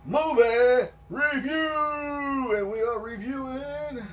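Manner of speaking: high, slow, booming announcer voice; grand announcer call. Acted. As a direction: stretch it out like a showman. Podcast intro announcement.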